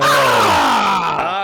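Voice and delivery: loud cheering shout; excited cry. Real, not acted. Player cheers at roll.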